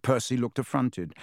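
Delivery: usual speaking voice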